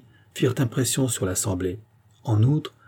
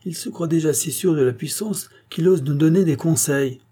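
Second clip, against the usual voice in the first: higher-pitched, mildly indignant outcry; indignant exclamation; 6 semitones higher than usual. A narrator's reading. Gadet interrupts the minister's reading, indignant exclamation. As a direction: exclaim it as a heckler would. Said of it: "s'écria Gadet".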